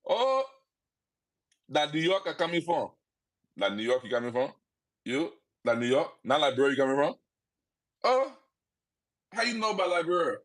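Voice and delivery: put-on very high, teasing Liberian English accent. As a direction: tease him in Liberian English.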